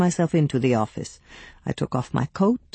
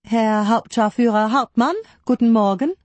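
higher-pitched, precise German phone greeting; 7 semitones higher than usual. A narrator's reading. Secretary answering the officer's telephone, in precise German. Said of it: "in my precise German".

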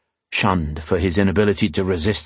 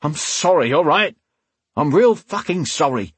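higher-pitched, exasperated apology; spat out; 7 semitones higher than usual. A narrator's reading. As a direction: spit out a heated, unwilling apology. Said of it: "he spat".

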